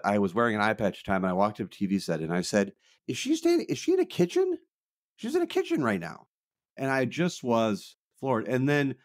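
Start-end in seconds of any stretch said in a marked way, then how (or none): 3.1-6.3 high-pitched retold disbelief; mock incredulous. Stunned viewer asking the TV set, high mock incredulity. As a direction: squeak it out in disbelief, far above your usual pitch. Retelling her stunned reaction at TV.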